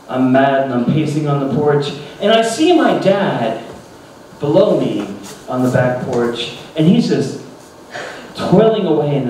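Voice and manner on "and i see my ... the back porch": normal talking voice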